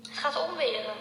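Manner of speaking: usual speaking voice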